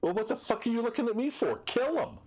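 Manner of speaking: an exasperated gang boss barking orders; thug leader outburst. Acted. GM voicing the gang leader ordering the kill, high thug shout. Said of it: "and he goes".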